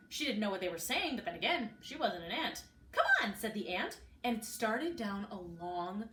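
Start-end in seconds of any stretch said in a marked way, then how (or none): 2.9-3.3 very high squeaky ant; tiny ant voice. A narrator's reading. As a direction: squeak impatiently at Sarah. The ant leads Sarah on.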